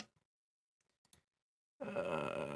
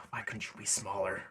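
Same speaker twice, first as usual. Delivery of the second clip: muttering; 13 dB quieter than usual. Acted. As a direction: mutter it to yourself, exasperated. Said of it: "looks at him and mutters".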